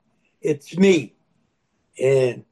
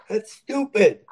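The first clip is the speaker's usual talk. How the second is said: ordinary delivery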